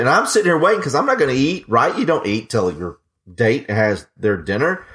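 usual speaking voice

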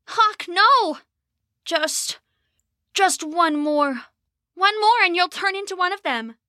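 high, frantic plea; desperate pleading. Acted. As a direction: beg him to stop, panicked. Rose desperately pleads with brother.

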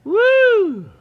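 high, loud breathless whoop. Real, not acted. Out of breath after hurrying back, a real whoop. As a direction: let out a big real woo.

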